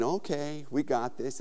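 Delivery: very soft, low murmur to yourself; hushed self-reassurance. Retold or imitated. Creeping past the sleeping figure, quietly reassuring himself. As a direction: hush it right down before the scare.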